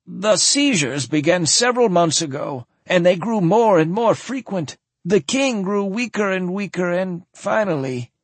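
put-on old noble recounting a death gently; sad old man voice.

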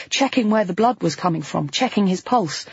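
usual speaking voice

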